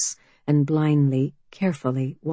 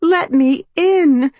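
put-on higher, louder, pleading young woman; anxious young woman; 10 semitones higher, 7 dB louder, slower than usual. A narrator's reading. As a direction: call out, nervous and hopeful. Mallory pleading.

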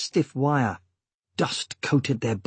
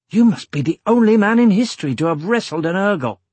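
high-pitched, lively and amazed; excited praise after a fight; jubilant comrade; 8 semitones higher, 4 dB louder than usual. A narrator's reading. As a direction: congratulate a friend with delighted admiration, pitch well up. Grinning comrade congratulates the winner.

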